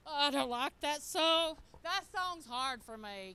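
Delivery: loud, slow whining protest; whiny complaint. Retold or imitated. Pastor retelling her own complaint about a hard song, loud and whiny. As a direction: whine to get out of it, drawn out. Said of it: "I'm like".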